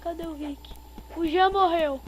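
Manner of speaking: high, loud frantic panic; panicked. Real, not acted. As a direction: babble in fright. Killer behind him.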